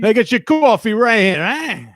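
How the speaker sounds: hammy New York vendor calling out; New York accent. Shown as a demonstration. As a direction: Brooklyn guy hawking coffee, loud and high. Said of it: "do it like Vic would do it".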